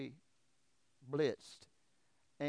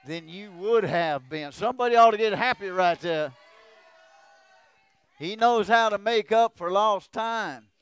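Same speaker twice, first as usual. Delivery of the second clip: loud, high, shouted preaching climax; preacher shouts the promise to the church; preaching at full force; 7 semitones higher, 19 dB louder than usual. Acted. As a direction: drive it home to the congregation, full volume.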